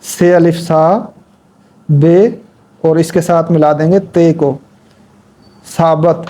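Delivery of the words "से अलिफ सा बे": slow, letter by letter; slow spelling out. Shown as a demonstration. Teacher spelling a word for Urdu learners, slowly. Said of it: "उसके बाद हम लगते हैं साबत".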